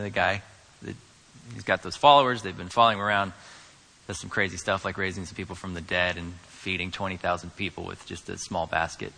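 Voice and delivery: low, soft, casual explanation; understated, unimpressed bystander; offhand townspeople. Acted. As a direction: toss it off as no big deal. Townspeople's offhand answer, acted.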